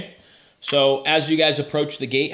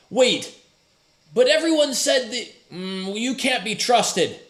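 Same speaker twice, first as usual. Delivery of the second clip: suspicious guard; 8 semitones higher than usual. Acted.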